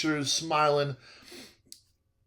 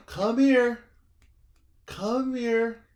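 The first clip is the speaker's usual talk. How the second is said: high, coaxing mock old-lady call; high-pitched, drawn-out imitation of her summons; beckoning old lady; 8 semitones higher, much slower than usual. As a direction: beckon him over in a high little voice. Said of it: "does this little number".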